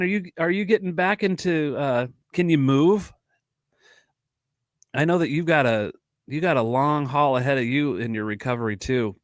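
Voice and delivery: usual speaking voice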